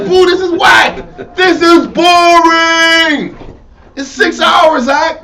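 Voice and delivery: bored heckler. Retold or imitated. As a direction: moan at the show like a fed-up crowd. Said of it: "They're like".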